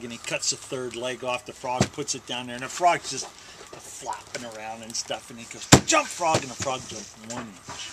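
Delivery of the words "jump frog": higher-pitched order at usual volume; mock command. Boy repeats his test on the frog, mock command. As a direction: give the frog a raised, acted order.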